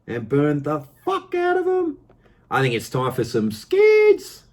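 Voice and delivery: high-pitched, excited hype, stretching the key words; fired up. Real, not acted. Car vlogger hyping burnouts to viewers, fired up. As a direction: let it soar with burnout excitement.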